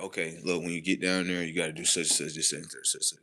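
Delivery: brief them in low tones; hushed briefing. Acted. Acted quote, dream-realm briefing.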